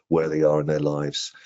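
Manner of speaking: ordinary delivery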